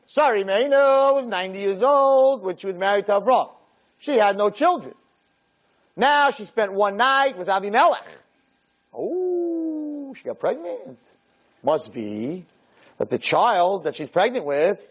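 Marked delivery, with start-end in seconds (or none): 0.1-11.0 mocking jokers. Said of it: "the jokers of the generation, said".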